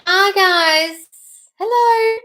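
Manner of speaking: high, loud, bright hello to the chat; squealing hi to viewers; excited greeting. Real, not acted. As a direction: greet them with a burst of delight.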